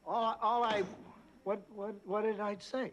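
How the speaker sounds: high, stammering bewilderment; bewildered protest. Acted. Co-host left facing blame for host's exit, high bewildered stammer. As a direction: sputter in helpless defence.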